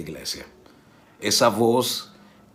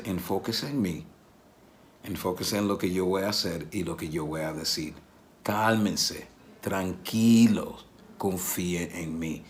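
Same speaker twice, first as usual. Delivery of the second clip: soft reassurance; 4 semitones lower, 5 dB quieter than usual. Retold or imitated. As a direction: draw them in quietly to focus. Jesus calming people, softly.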